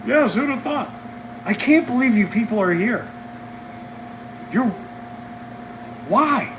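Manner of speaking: bubbling over with delight; excited. Real, not acted. Excited banter with customers.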